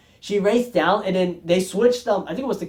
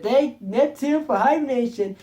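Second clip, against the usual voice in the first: higher, slow mock SpongeBob voice; SpongeBob impression; 7 semitones higher, slower than usual. Reviewer quoting SpongeBob's line in a lightly put-on voice. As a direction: stretch it out in a cartoon sponge voice. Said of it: "I can't do it like a SpongeBob impersonating".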